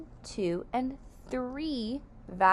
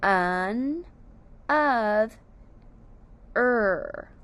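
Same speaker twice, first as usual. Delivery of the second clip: Un, of, er as three held syllables with long gaps; split into syllables; 3 dB louder, much slower than usual. Shown as a demonstration. Teacher showing the syllables of another, split into syllables. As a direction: stretch and separate Un, of, er. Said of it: "The last syllable is er".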